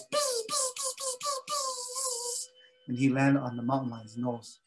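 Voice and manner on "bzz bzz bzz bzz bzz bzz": very high thin buzzing; mosquito buzz. Acted. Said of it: "And then he goes".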